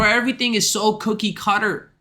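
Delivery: usual speaking voice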